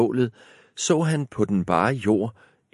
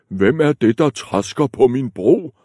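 loud troll bellow; troll yelling from under the bridge; troll shouting; 4 semitones higher, 6 dB louder than usual. Acted. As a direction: shout it down at the goat. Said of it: "råbte trolden".

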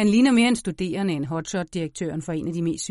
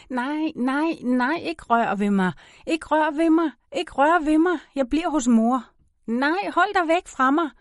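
put-on high, frightened child's cries; acted panicked pleading, pitched well up; child screaming in protest; 8 semitones higher, 3 dB louder than usual. A narrator's reading. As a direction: fight off the touch with rising panic. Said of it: "Jeg skriger".

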